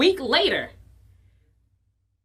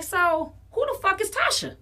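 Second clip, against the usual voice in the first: high indignant demand; 8 semitones higher than usual. Retold or imitated. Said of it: "I was like".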